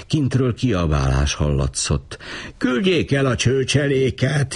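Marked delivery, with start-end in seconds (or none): 2.6-4.5 higher, wailing plea. A narrator's reading. The fat man wails to send the mob away. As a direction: whine it out in dismay. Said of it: "jajdult fel a férfi".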